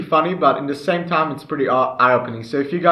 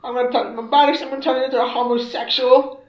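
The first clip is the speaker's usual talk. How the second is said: mock high silly voice; 9 semitones higher than usual. Imitating the instructor character.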